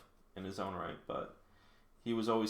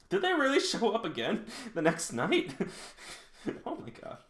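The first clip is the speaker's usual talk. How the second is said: laughing through the words, high and loud; incredulous, breaking into laughter; laughing disbelief; 12 semitones higher, 4 dB louder than usual. Real, not acted. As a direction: crack up mid-question. Reader laughs at the plot.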